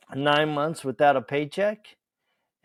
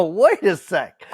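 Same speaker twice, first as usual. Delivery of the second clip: taken aback; 5 semitones higher, 7 dB louder than usual. Retold or imitated. Arguing with the new CEO.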